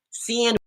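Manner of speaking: sand with a tensed "see-and" vowel; South Jersey "sand". Shown as a demonstration. Husband showing South Jersey accent, sand as "see-and". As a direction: stretch sand toward "SEE-and". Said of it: "We say sand".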